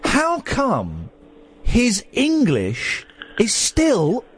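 high, incredulous, drawn out; exasperated rant. Real, not acted. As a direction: stretch out "his English is still" in disbelief. Host ranting about Jackie Chan's English.

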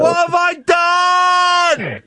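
slow, high anguished shout; anguished cry. Retold or imitated. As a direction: wail it in mock despair. Realising it was Coldplay.

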